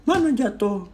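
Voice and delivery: high-pitched, doubting mimicry; disbelieving challenge. Partner doubting that the phone was really dropped, disbelieving. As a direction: question it like a suspicious partner.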